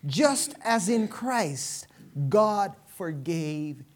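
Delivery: loud, high-pitched proclamation, slowing toward the end; forceful Scripture preaching. Real, not acted. Preacher reading Paul's words to the congregation, loud and emphatic. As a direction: proclaim each phrase to the whole church.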